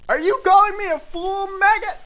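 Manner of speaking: very high, loud challenge; furious yell. Acted. Schnorks takes offence at Yumbly, yelling furiously. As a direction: scream it as an outraged demand. Acted quarrel climax.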